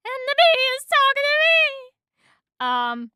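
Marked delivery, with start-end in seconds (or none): 0.1-1.9 high, loud squeal; hysterical disbelief. A narrator's reading. Woman realizes the bee talks back, squealing. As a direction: shriek it in amazement.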